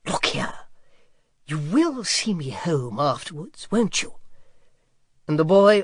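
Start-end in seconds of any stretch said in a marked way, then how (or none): 0.1-4.1 a touch of a pompous dragon, slow; impressive dragon. A narrator's reading. Dragon presses the boy to see him home, slow and impressive. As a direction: lean in and press the point, slowly. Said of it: "said impressively".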